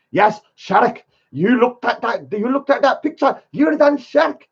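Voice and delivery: lightly voiced gloating host telling Shark he's done; mocking the channel hosts. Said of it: "they're going".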